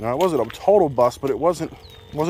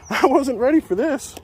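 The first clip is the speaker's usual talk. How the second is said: high, loud, laughing through the words; laughing excitedly; 13 semitones higher, 4 dB louder than usual. Real, not acted. Angler fighting a fish he wasn't ready for, laughing high. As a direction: laugh it out while scrambling.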